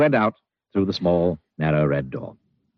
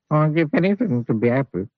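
wistful wish; 7 semitones higher than usual. Acted. Mister Miserable wishes.

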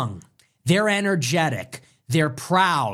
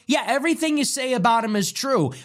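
usual speaking voice

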